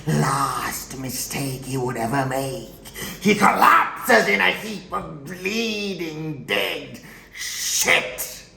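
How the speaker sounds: loud, high, building burst; worked-up gloating. Acted. Killer recounting his first victim's death, fierce gloating. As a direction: relish the kill, climbing high and loud.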